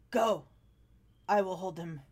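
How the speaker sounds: a hint of a stern soldier, low at the end; calm, resolute order; lightly voiced sergeant. Sergeant tells them to flee, lightly voiced resolve. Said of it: "and he says".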